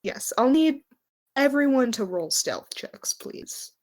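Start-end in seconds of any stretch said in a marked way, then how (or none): none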